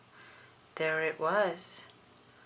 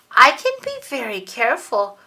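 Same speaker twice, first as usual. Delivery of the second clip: loud, higher plea; pleading little sister; 5 semitones higher, 9 dB louder than usual. Acted. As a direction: beg to be allowed to play.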